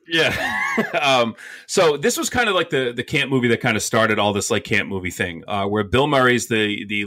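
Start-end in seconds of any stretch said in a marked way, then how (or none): 0.4-0.9 squealing burst of laughter; a short high-pitched laugh. Real, not acted. Laughs at the joke.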